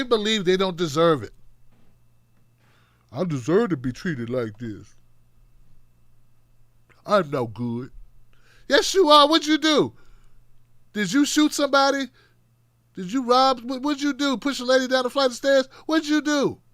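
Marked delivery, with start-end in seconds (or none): 3.1-7.9 low, mopey voice of a beaten-down worker; mock self-pity. Host mimicking a poor person's self-blame, low and mopey. 8.7-16.6 higher, pressing questions; incredulous challenge. Acted. Host grilling an imagined self-blamer, higher and insistent.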